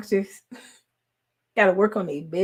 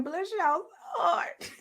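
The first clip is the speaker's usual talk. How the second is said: laughing pity; 9 semitones higher, 6 dB quieter, slower than usual. Real, not acted. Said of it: "I'm laughing at y'all men".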